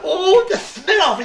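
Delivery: high, slow and loud with laughter; slow high laugh of disgust at the stink. Acted. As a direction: wrinkle your nose and laugh it out. Doctor disgusted by cat's eye.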